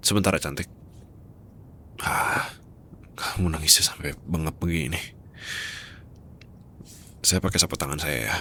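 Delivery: ordinary talk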